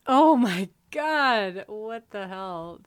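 loud, high, drawn-out exclamation sinking on the second half; exasperated outburst. Real, not acted. Podcast guest reacting to the movie's Portuguese accents, exasperated. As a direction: exclaim slowly in real disbelief.

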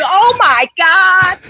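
high, drawn-out American accent. Shown as a demonstration. Showing the one American bit needed, exaggerated American accent. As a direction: stretch it out in a mock American accent. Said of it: "that's the only bit I need to know".

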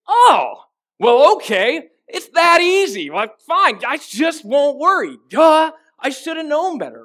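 high, loud, sarcastic whine; mock the useless advice with exaggerated sarcasm; sarcastic mock reply. Pastor mimicking a worried listener's reply, sarcastic and loud. Mocking reply to being told not to worry.

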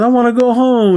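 high-pitched imitated whine; hammed-up plea to leave; mock whining. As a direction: whine it like a scared kid, exaggerated. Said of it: "he's just pussying out".

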